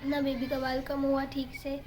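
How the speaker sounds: ordinary delivery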